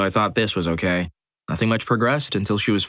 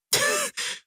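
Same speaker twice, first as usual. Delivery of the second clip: retold laugh; 5 dB quieter than usual. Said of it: "burst out laughing".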